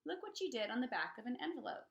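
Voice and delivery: plain reading voice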